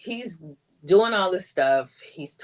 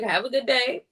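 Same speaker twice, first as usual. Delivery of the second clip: sarcastic farewell; 9 semitones higher than usual. Retold or imitated.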